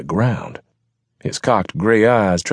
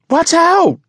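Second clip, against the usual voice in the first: very high, loud scream of alarm; screamed warning; 7 dB louder than usual. Acted. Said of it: "Sassafras screamed".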